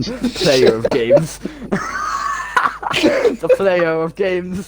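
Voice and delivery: mock-grand words breaking into laughter; laughing through the line. Friend hamming up the poem, laughing through it. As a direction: try to stay solemn and crack up.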